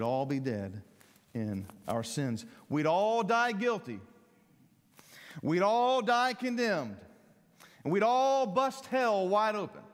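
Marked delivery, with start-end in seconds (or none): 2.7-9.8 loud, high, rising preacher's cry; preaching at full force. Acted. Preacher thundering at the congregation about dying in sin. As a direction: drive it home to the congregation.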